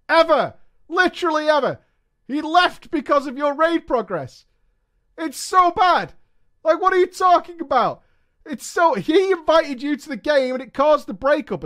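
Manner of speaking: high, loud outraged protest; incredulous rant. Real, not acted. Ranting at a story's breakup.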